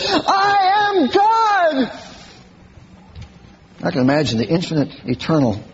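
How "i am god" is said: high, drawn-out proclaiming cry; mock Shirley MacLaine. Preacher mocking an actress on the seashore, drawn-out high cry. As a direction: stretch it out like a grand revelation. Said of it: "she says".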